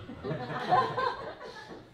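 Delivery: high burst of laughter at own joke. Real, not acted.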